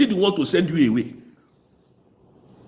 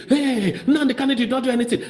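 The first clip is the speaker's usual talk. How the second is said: mock quote; 9 semitones higher than usual. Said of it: "this woman was in court yesterday".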